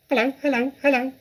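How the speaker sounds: high squawky parrot imitating a human. Parrot greeting the children with a high squawk. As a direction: chirp it out like a talking bird. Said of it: "the bird called down to her".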